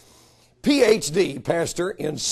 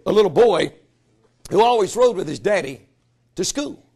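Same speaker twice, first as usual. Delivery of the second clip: usual speaking voice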